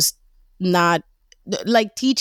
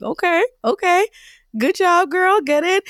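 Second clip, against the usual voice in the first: put-on high, bright hype-girl cheer; mock cheering; 11 semitones higher than usual. Mom hyping daughter who taught a friend to journal, high cheer. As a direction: cheer her on like a proud friend. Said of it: "And I'm sitting there like".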